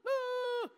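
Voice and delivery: high mock-drowning yelp; high, drawn-out "ah"; panicked flailing cry. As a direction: cry out as if floundering in water. Acts out flailing in the water.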